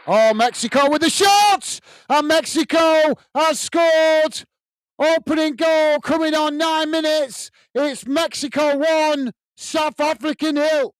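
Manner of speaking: high, excited goal shout; goal call. Real, not acted. Commentator calling Mexico's opening goal to viewers, excited high shout. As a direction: erupt as the ball hits the net.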